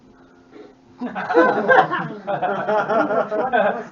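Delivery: loud, high bugbear-mocking laugh; big mocking laugh. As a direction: laugh him off like a boss. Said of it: "He starts laughing".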